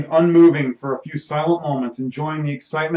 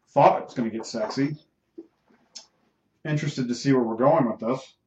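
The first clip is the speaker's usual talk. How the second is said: ordinary delivery, nothing marked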